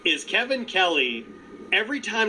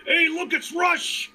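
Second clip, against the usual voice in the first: mocking Taz; 9 semitones higher than usual. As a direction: ham up a clueless commentator. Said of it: "expecting Taz to go like".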